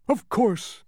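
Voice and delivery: "Of course" high and loud; "Of course" as emphatic high agreement; emphatic bug. A narrator's reading. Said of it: "emphasized the bug".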